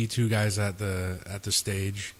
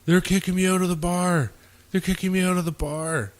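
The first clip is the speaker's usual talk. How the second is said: higher, whiny retold yell at a dispatcher; mock yelling; 8 semitones higher than usual. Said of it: "he was yelling at the dispatcher".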